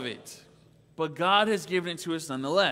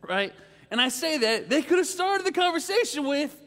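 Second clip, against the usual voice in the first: usual speaking voice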